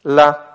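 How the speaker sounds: LA said alone, ending on the vowel; single syllable «ла», pauses either side; isolated open syllable. Shown as a demonstration. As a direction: set «ла» apart as an open syllable. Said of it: "первый слог открытый".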